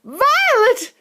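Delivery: very high, loud scream; screaming mother. Acted. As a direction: shriek her daughter's name. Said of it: "screamed Missus Beauregard".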